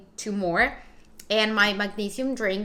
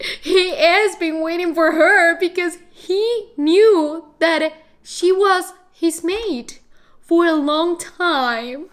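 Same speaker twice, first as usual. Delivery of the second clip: slow, higher and louder, overwhelmed; swooning gush; 7 semitones higher, 8 dB louder, slower than usual. Real, not acted. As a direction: gush slowly like you're about to cry. Gushing about Rhys.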